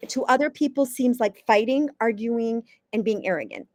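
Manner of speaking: ordinary talk, nothing marked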